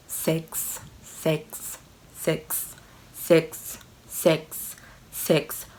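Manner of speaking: hushed, breathy repeated whisper; whispered repetition. Acted. Telling viewers he only talked about sex, whispering. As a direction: whisper it over and over like something forbidden.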